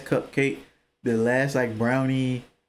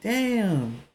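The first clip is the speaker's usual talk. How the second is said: surprised; 9 semitones higher, slower than usual. Retold or imitated. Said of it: "And I'll be like".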